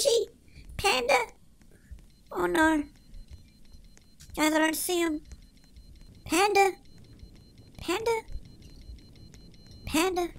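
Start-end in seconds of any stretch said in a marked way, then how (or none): 4.4-10.3 soft worried calls; quiet calling. Real, not acted. Gamer searching for missing Panda, soft worried calls. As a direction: call out under your breath.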